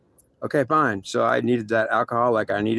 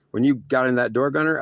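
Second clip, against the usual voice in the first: ordinary delivery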